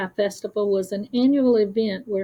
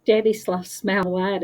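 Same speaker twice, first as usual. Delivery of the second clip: ordinary delivery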